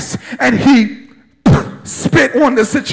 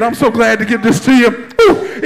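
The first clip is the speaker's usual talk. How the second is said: ordinary talk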